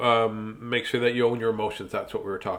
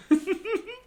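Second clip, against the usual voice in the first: high giggle. Real, not acted. Laughs at own silliness.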